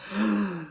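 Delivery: a sharp surprised gasp. A narrator's reading. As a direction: gasp as if stunned. Charlie sees gold ticket.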